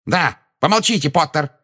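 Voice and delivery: high, curt order; barking. A narrator's reading. Minister silences Harry, barking. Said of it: "пролаял Фадж".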